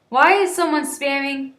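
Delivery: plain reading voice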